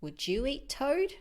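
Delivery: plain reading voice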